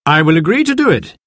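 doctor lays out what he has heard; lightly voiced doctor. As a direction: speak as the measured, explaining doctor.

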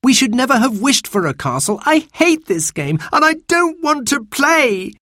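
put-on high, protesting child's voice; upset child. A narrator's reading. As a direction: whine in protest as a fed-up child. Frightened child in story dialogue.

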